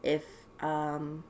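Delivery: ordinary talk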